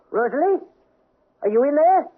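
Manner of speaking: high, rising call to the maid; questioning call. Acted. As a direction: call out as if through a closed door. Calling to maid behind closet door.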